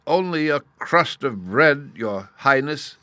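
put-on slow, high, imploring old beggar; pleading. A narrator's reading. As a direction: beg for it, drawing out the words. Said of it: "he pleaded".

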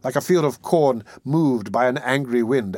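plain reading voice

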